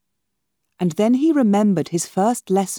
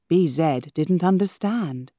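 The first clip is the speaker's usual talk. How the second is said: ordinary delivery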